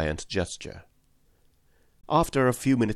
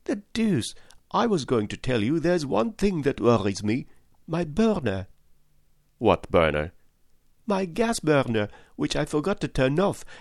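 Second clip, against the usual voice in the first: higher, worried chattering servant voice; fretting excitable valet; 7 semitones higher than usual. A narrator's reading. Valet telling Fix of his gas bill, fretful higher voice. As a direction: fuss over the forgotten gas burner.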